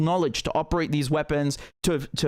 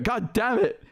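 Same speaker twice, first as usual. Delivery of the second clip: normal talking voice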